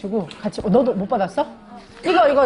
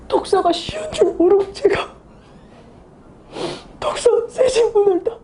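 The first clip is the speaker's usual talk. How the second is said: higher, tearful sobbing voice of a student; imitating crying student; 9 semitones higher than usual. Ex-soldier student cries to teacher about his exam, sobbing. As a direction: act out a crying student, voice breaking. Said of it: "막 이러면서 막 우는 거야".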